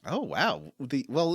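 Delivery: ordinary talk, nothing marked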